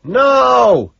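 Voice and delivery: high, drawn-out yell; one loud, high shout; shouting back. A narrator's reading. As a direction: holler the answer back. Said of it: "Owen shouts back".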